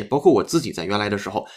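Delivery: ordinary talk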